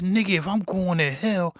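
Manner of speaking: mock-tough reply to the churchgoer; defiant comeback. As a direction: toss it back at him with swagger. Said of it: "I said".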